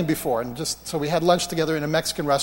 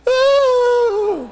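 loud, very high "ooh"; high-pitched squeal of a whoop; excited whoop; 7 dB louder than usual. Acted. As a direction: let out a long high whoop that drops at the end. Excited reaction in the retold healing.

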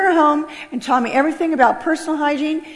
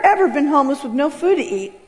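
normal talking voice

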